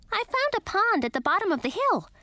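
high tiny creature chatter; squeaky bat voice. A narrator's reading. Lil the bat's voice.

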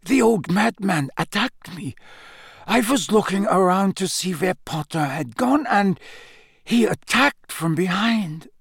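Krum, dazed. A narrator's reading. Krum telling Dumbledore who attacked him, dazed. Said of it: "Crumb muttered".

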